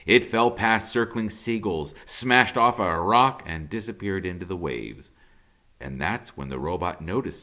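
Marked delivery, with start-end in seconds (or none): none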